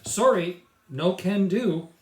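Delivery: high, loud and slow polite no; cheerfully decline, drawn out; a kid astronaut refusing bedtime, politely; polite refusal. Acted.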